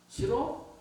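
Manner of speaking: very high taunting question; taunting challenge; mock challenge. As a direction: dare him to refuse.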